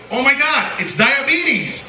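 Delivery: high, slow mock delight; mock kid excitement. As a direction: gush over bad news like a thrilled kid. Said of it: "and you go like".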